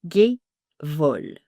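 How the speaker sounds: "Gevol" slowly; drawn-out "Gevol"; slow model "Gevol"; slow pronunciation. Shown as a demonstration. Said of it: "On prononce Gevol".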